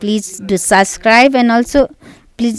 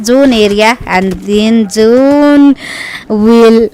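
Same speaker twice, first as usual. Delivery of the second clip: louder, driving esports commentary; live play-by-play; 4 dB louder than usual. Acted. Caster calling a PUBG Mobile fight to viewers, live play-by-play. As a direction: call the fight like a caster on the mic.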